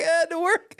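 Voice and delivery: dad's excuse, squeaked out through laughter; high laughing dad voice. Retold or imitated. Dad apologizing to son, laughing high mock voice. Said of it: "He was like".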